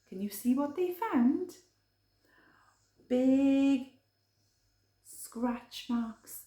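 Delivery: ordinary delivery, nothing marked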